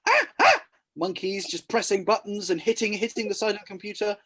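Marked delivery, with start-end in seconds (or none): 0.1-0.6 loud high monkey-like shrieks; hammed-up ape cries. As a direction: shriek like a confused monkey at a computer. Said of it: "they both start getting on the game and go".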